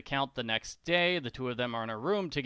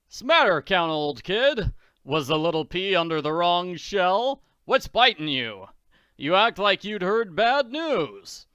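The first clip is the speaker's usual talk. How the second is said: loud, higher jovial taunting; hearty mocking Daddy; 5 semitones higher, 7 dB louder than usual. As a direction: slap him with cheerful mockery.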